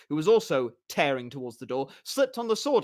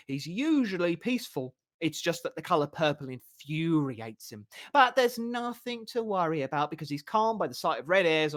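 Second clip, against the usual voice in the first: bard spinning a tale; 3 semitones higher than usual. Acted. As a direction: spin the lie with relish. Bard spins the cover story.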